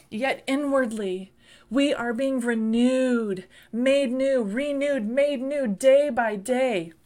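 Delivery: hammering revival preaching; full-force preaching. Acted. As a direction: preach it hard to the church.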